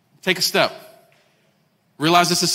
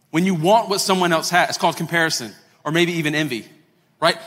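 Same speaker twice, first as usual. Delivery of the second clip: ordinary delivery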